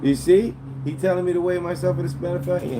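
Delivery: indignant. Real, not acted. Outraged at chat insult.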